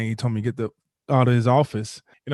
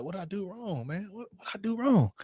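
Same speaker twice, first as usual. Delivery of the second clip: higher, anguished plea toward the sky; anguished cry to the sky; 7 semitones higher, 6 dB quieter than usual. Retold or imitated. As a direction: ask the sky what went wrong, close to tears. Tear down face, looking at sky.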